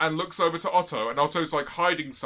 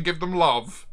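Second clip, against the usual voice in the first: ordinary delivery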